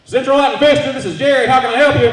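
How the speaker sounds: loud, high phone greeting; fake business greeting. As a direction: shout it like a bored clerk. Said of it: "we would say".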